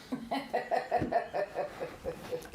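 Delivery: breathy high giggle after the punchline; short amused giggle; laughing at own joke. Real, not acted. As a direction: laugh off the silly name.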